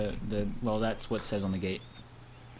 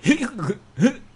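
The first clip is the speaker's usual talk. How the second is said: nervous giggle; 12 semitones higher, 7 dB louder than usual. Acted. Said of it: "I gave a nervous chuckle".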